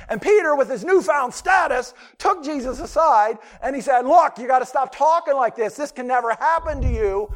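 loud, high, pushy protest; insistent rebuke. Acted. Peter taking Jesus aside to stop his talk of death, loud insistent protest. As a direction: pull Jesus aside and tell him off.

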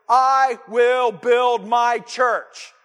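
loud, very slow, word by word; slow ringing declaration; booming proclamation. Shown as a demonstration. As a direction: declare it grandly, each word weighted.

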